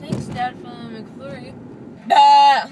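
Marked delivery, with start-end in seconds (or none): 2.1-2.7 loud bleating goat noise. Retold or imitated. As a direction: bleat like a goat, full volume. Repeating her goat bleat.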